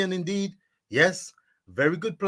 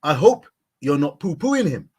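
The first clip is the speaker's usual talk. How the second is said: ordinary talk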